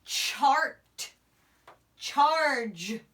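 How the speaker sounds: ordinary talk, nothing marked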